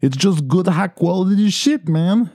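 put-on high, Italian-Venezuelan accent, slow on quality shit; Nick's accent. Host imitates producer Nick's odd Italian-Venezuelan accent. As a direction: mimic an Italian accent filtered through Venezuelan Spanish. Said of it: "That's kind of how he sounds".

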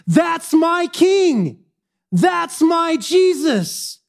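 high, slow proclaimed refrain; borrowed preacher's refrain. Acted. Pastor borrowing a famous refrain, drawn out and proclaimed. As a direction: preach each word, drawn out. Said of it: "I'm gonna borrow a phrase".

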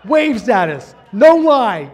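loud thrilled exclamation; excited exclamation. Real, not acted. Telling listeners the baby waved, a loud thrilled burst. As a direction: burst out with delight at the memory.

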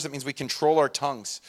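ordinary talk

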